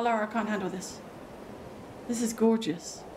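ordinary talk, nothing marked

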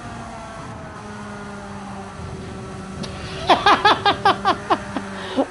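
long run of quick, short laughs; real rattling laugh; staccato laugh. Real, not acted. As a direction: laugh helplessly in rapid bursts. Laughs at own bad lap.

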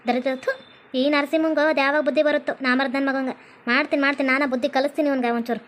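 low, contemptuous grumble; grumbling scold. Acted. Vowing to teach him a lesson, low scolding. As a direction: grumble disgusted threats in a low voice.

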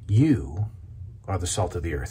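ordinary delivery

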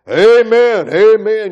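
loud, high crowd amens; mock congregation. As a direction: echo the crowd's approval. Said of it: "and the people".

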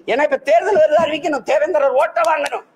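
high, loud, indignant shout; angry outburst. Real, not acted. Politician shouting angrily at the government over votes. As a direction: bellow it at the ruling party.

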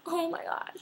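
high, soft gasp of wonder; hushed amazement. Retold or imitated. Shoes fit perfectly, soft amazed gasp. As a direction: breathe it out in awe. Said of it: "And I'm like".

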